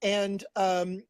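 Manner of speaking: normal talking voice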